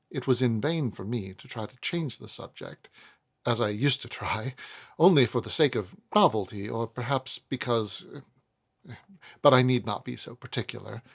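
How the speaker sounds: ordinary delivery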